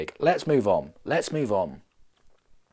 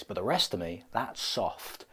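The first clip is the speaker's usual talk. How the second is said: normal talking voice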